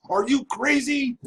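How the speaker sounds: high mock judge asking are you crazy; imagined judge. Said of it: "I wanted him to actually say".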